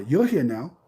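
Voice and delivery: normal talking voice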